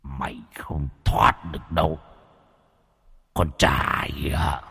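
low, slow villain threat; menacing villain. Acted. A ghostly villain taunting the fleeing young man, low and slow. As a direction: drawl the threat out slowly like a lurking demon.

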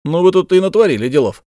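high, quick, loud and admiring; delighted giant's exclamation; joyful exclamation. A narrator's reading. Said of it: "радостно вскричал великан".

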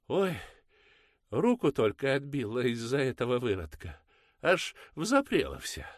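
high, loud peasant-woman wail; loud complaining mother. Acted. Mother complaining to the priest, high and loud. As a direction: whine it up high and loud to win pity.

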